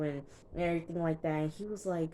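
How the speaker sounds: normal talking voice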